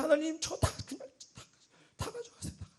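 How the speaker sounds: high, hushed mock plea; total surrender prayer. Giving everything to God, a high hushed plea. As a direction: plead high and fade to a whisper. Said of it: "그냥 그때는 뭐 그냥 더 드리는 거예요".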